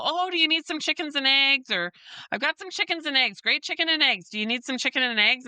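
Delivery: high, peppy market vendor calling to shoppers; upbeat sales pitch. Retold or imitated. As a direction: sell it with eager cheer. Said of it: "and I would say to people".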